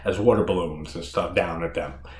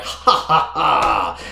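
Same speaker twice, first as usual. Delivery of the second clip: mock evil villainess laugh; evil supervillain cackle; 2 semitones higher, 3 dB louder, much slower than usual. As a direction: cackle like a campy old-time supervillainess. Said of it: "evil supervillain female version".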